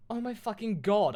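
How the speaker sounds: high, loud outcry; exclaiming. Acted. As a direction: cry it out in melodramatic shock. Exclamation opening the new chapter.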